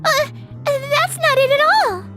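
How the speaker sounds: high-pitched, stammering protest; flustered denial. Acted. Flustered denial of avoiding him.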